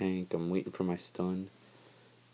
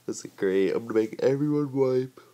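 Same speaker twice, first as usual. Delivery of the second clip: loud, higher mock cheer; sarcastic; 4 semitones higher, 7 dB louder than usual. Retelling his panic to viewers, loud sarcastic mock cheer. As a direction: fake delight over certain failure. Sarcastic retold self-talk.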